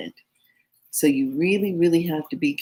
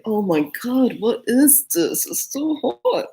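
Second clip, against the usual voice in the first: rising higher, slowed, alarmed; mock panic, slowing; 7 semitones higher than usual. Recalling the burning sauce, slow rising mock panic. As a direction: drag it out like your mouth is burning. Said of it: "I was just like".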